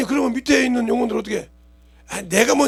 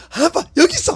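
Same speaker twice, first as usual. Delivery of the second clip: put-on little child's voice; 10 semitones higher, 7 dB louder than usual. Child hands dad the rod.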